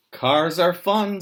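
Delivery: high, loud gleeful pig; bright burst of joy over the cars; excited pig. Acted. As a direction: cheer it like a pig having fun. Pig voiced excitedly in story.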